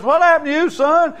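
very high, loud alarmed question; shocked preacher. Acted. Preacher startled by the bloody boy, crying out very high. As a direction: gasp at the sight of him.

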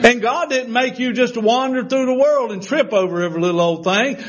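full-force preaching. Acted. Preacher telling the church God made them, soaring. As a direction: lift the room, stretching each phrase.

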